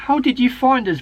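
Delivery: high, loud rush of words; blurted question. A narrator's reading. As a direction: blurt it out in relief. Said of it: "blurted Bonnie".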